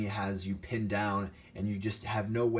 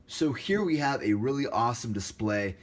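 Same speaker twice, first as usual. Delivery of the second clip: ordinary delivery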